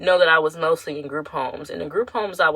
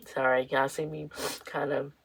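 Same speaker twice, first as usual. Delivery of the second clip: quiet, trying not to cry; tearing up; 2 semitones lower, 7 dB quieter than usual. Real, not acted. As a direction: apologise softly through welling tears. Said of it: "tearing up".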